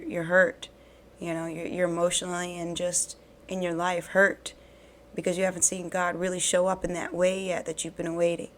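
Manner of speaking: ordinary delivery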